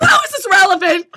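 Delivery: high, loud exasperated wail; mock despair. Student despairing over irrelevant survey, mock anguished shout. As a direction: yell it in hammed-up desperation.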